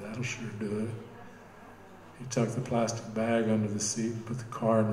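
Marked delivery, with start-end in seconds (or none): 0.0-0.9 snorting. A narrator's reading. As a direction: scoff it out under your breath. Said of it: "Ray Bob snorted and said".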